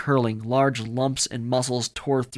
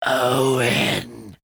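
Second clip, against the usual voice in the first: loud, drawn-out growled name; monster growl; 2 semitones higher, 6 dB louder than usual. A narrator's reading. Rat beast lurching towards Owen, growling. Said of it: "the thing growled as it lurched".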